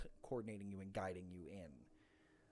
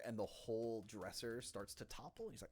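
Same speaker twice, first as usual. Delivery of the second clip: ordinary talk, nothing marked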